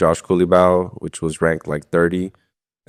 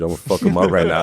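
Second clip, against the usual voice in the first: laughing hype; 9 semitones higher, faster than usual. Retold or imitated. Retelling hyped self-talk before fight.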